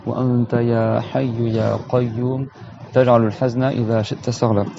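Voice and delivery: solemn Arabic supplication; prayer. Real, not acted. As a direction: recite the dua devoutly.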